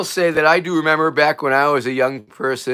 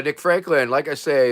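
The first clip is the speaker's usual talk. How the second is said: ordinary talk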